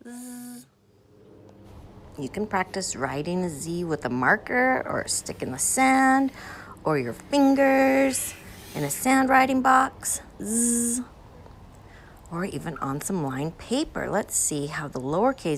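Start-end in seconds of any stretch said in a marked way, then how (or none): 0.1-0.7 "zzz" as a long steady buzz, voiced z held; buzzing z sound. Shown as a demonstration. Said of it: "Sound like a bee's flying around". 10.4-11.0 "zzz" as a long steady buzz, voiced z held; buzzing z sound. Shown as a demonstration. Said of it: "Sound like a bee's flying around".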